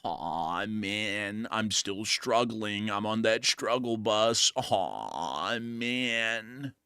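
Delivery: hammed-up gloomy groan; sad-sack fly. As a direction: moan like a defeated fly. Plays the fly, deflated.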